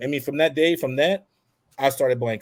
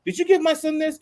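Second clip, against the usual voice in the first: mom confronting; 13 semitones higher than usual. Retold or imitated. Mom confronting son's classmate.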